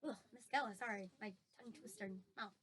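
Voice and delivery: ordinary delivery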